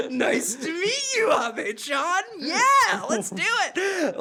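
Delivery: high, loud, laughing excitement; over-excited greeting. Retold or imitated. Tourists greeting the bartender, hammed-up excitement.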